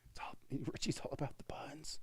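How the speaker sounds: soft unvoiced whisper. Real, not acted. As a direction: say it in a hushed whisper. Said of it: "Why are we whispering?".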